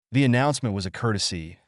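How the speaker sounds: plain reading voice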